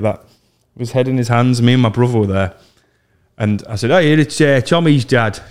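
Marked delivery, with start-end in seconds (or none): 3.9-5.4 lightly put-on middle-class dad voice. Prank caller posing as Tommy's dad, middle-class voice. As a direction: pose as a middle-class father on the phone. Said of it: "I'm gonna pretend to be his dad".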